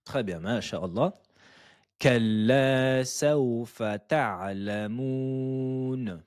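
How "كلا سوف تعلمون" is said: measured tajweed chant of the verse; Quran recitation. Shown as a demonstration. Teacher reciting the verse for students, chanted. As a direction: recite it clearly for students to copy. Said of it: "À vous".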